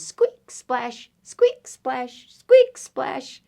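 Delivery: high squeak, then a splash; high-pitched animal squeaks; squeaky animal. Acted. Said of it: "and he goes".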